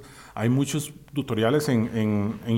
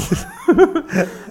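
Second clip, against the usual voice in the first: high, loud laugh; real laughter; 9 semitones higher, 5 dB louder than usual. Real, not acted. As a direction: crack up at your own joke. Laughing at mask joke. (risa aguda y fuerte)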